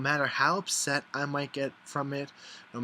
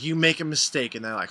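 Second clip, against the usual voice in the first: ordinary talk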